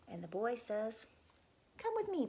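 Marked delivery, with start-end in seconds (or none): 1.8-2.3 put-on boy urging. Boy urges his father.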